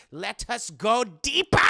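put-on loud, slow, rising German-accented command; hammed-up German doctor building to a shout; Hugo Strange. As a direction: urge dramatically in a comic German accent. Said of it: "a very thick German accent".

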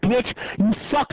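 screaming furious gamer rage; berserk rage. Real, not acted. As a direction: scream insults at full volume, seething. Raging gamer screaming at opponents.

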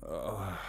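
soft waking groan; low groan. A narrator's reading. Narrator rolls over in bed, groaning softly. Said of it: "I groaned as I rolled over".

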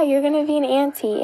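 ordinary delivery

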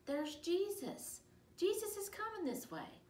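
high, excited call; crying out. A narrator's reading. Someone in the crowd spotting Jesus, crying out. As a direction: call the news out down the street. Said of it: "someone cried out".

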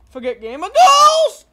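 high, loud shout; mock outburst. Gamer boy joking to viewers, sudden shout. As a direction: yell it suddenly at full volume.

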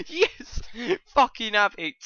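high, loud cackle into yelling; real elated outburst; triumphant shout. Real, not acted. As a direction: celebrate with a gleeful roar. Celebrates winning a penalty.

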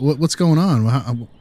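usual speaking voice

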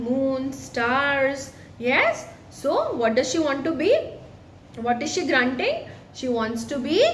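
ordinary delivery, nothing marked

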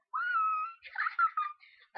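very high, loud squeal. Real, not acted. Vlogger starting her bucket list, piercing squeal. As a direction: squeal shrilly, breaking into laughter.

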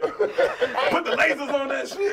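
slow, drawn-out hype shout; excited. Pretend. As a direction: stretch it out like an announcer. Laughing hype call.

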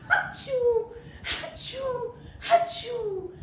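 big high sneezes, one after another; sneezing. A narrator's reading. Storyteller sneezing out the chili powder for the kids. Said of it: "They started sneezing".

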